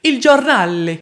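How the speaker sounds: high, loud, over-the-top mock Italian; caricature Italian accent. Narrator defiantly repeating the brand name, exaggerated Italian accent. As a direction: belt it out like a cartoon Italian. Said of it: "faire cet accent italophobe".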